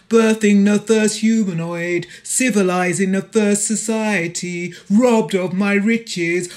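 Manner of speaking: loud, insistent litany; forceful declamation. Acted. Poet as Africa listing lands and losses, loud declamation. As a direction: proclaim each item with full voice.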